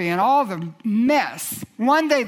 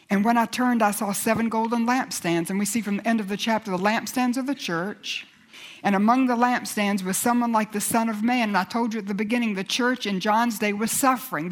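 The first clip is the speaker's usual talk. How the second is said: normal talking voice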